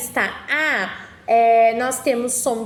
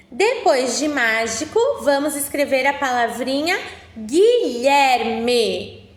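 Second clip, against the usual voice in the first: ordinary talk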